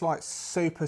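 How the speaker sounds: normal talking voice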